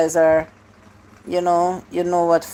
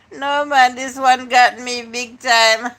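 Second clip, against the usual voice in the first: ordinary delivery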